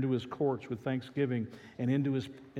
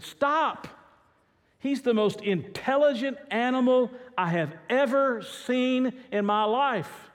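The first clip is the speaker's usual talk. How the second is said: much higher, louder indignant yell; yelled back; 10 semitones higher, 6 dB louder, slower than usual. Retold or imitated. Follower yelling back at the owner, high and loud. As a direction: shout him down in disbelief. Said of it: "yelled back and said".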